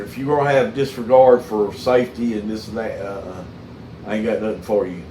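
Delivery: usual speaking voice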